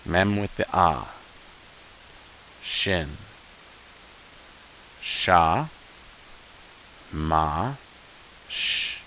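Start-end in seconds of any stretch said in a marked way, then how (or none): 2.6-9.0 slow, broken into sounds sha, ma, sh; sounding out letters. Shown as a demonstration. Teacher sounding out shamash piece by piece for students. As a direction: model each sound apart. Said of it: "So let's try to read this".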